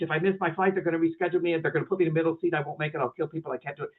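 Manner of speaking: rattled-off anxious spiral, very fast; fast, tumbling fretting; worked up. Acted. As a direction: rush through the worries, sinking away at the end. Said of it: "get a little worked up and upset".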